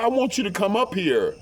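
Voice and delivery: high-pitched imitated request; retold plea. Mistress asks him to come.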